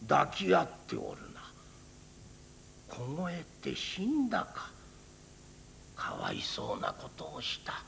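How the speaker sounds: put-on hushed, grieving old monk; sorrowful old priest. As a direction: mourn the frozen pair in a near whisper. Priest grieving over dead monkeys.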